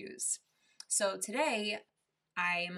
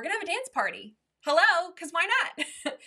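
playfully excited; 9 semitones higher, 9 dB louder than usual. Real, not acted. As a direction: whoop it up for a party. Announces a dance party.

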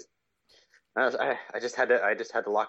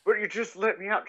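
high-pitched mock plea; small, high protesting voice; pleading captive; 11 semitones higher than usual. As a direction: whine it as the put-upon prisoner. Voices Nicolas Cage pleading from corner.

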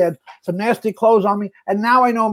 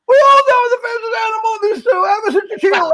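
much higher, louder, fast shouted impression; Kevin doing me; 12 semitones higher, 4 dB louder, faster than usual. Director shows the ostrich line, hammed-up shouting. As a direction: yell it like Kevin mimicking you. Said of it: "impression of Kevin doing me".

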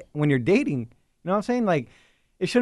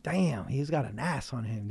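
much softer, slow, hushed; hushed quote; 5 semitones lower, 12 dB quieter than usual. Retold or imitated. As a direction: say it under breath, slowly. Quoting girls checking guys out.

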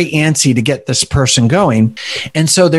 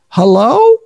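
higher, questioning hello; lightly voiced elderly man answering a call; a hint of a retired old doctor; old man on the phone; 8 semitones higher, 4 dB louder than usual. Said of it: "And he goes".

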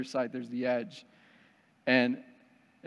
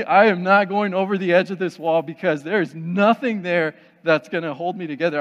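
loud, higher, emphatic protest; panicked refusal; 3 semitones higher, 7 dB louder than usual. Retold or imitated. Man at the wall refuses to go over, loud panicked protest. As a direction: replay an adamant refusal at full volume.

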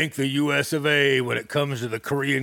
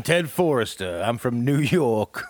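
low, tough New York guy voice; New York accent; 4 semitones lower than usual. Pretend. Comedian unveils his real self, New York accent. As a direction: drop into a lower New York tone. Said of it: "This is how I really talk".

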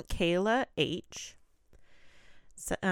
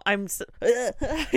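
nervous laugh; 4 semitones higher, slower than usual. Real, not acted. Laughing while spooked.

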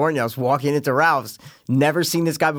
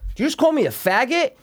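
high-pitched incredulous challenge; retold disbelief; 10 semitones higher than usual. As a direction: act out confronting someone, pitched well up but not shouted. Retells challenging the stranger.

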